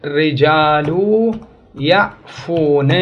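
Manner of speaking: slow demo. Shown as a demonstration. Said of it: "فالآن التفتوا".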